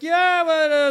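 high, loud whining objection; retold panicked protest. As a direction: imitate a scared kid protesting. Frightened boy protesting to the men.

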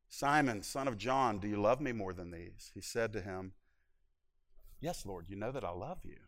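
low, hushed and tender; tender questioning. Retold or imitated. Jesus softly asks Peter if he loves him, low and hushed. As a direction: ask it softly, voice sunk low.

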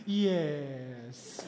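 low, drawn-out gentle answer; Pastor Timothy. Retold or imitated. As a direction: stretch it calmly, imitating the pastor. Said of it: "That's how he talks".

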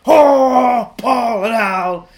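loud, slow crying-out voice; excited shout. Retold or imitated. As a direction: wail it with joy. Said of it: "he was like".

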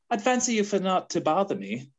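put-on British English accent; hammed-up polite British brush-off; mock British accent. Translating "Stop bothering me", mock British accent. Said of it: "But in British terms, it'd be like".

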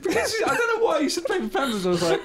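high-pitched laughing through the words; can't stop laughing while explaining; laughing hard. Real, not acted. Laughing at a friend's odd remark, high and giggly.

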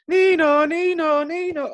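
high siren fading away; siren imitation fading. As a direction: wail like a police car leaving. Siren as cop leaves.

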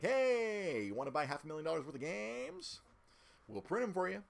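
high, loud call to a buyer; eager sales pitch. Retold or imitated. Voices company eagerly pitching to boss.

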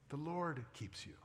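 soft, hushed repeated promise; tender reassurance. Real, not acted. Pastor softly assuring tired moms they are kept. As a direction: speak it softly like a blessing.

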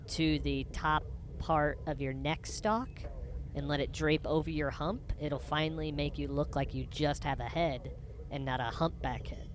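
ordinary talk, nothing marked

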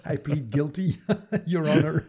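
laughing mock plea. Speaker jokingly pleading guilty to the other's tease, laughing. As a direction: say it through a chuckle.

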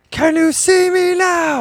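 loud, drawn-out high call; mock announcement wail, slow and high; high-pitched imitation. Re-enacting a surprise announcement.

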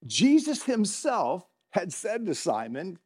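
usual speaking voice

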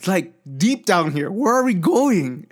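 high, stretched-out disbelief; bewildered friend. Retold or imitated. Friend confused heading onto field.

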